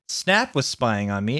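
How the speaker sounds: ordinary delivery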